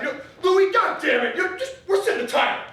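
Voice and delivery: high fed-up parent shouting; exasperated parent. Retold or imitated. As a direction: scold the kid, fed up and rushing. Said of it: "my parents would be like".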